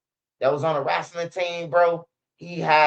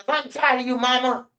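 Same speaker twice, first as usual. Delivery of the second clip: high, mock-snotty voice; bratty friend; 7 semitones higher than usual. Friend telling his mama off, high and snotty. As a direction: mimic the friend snapping at his mother.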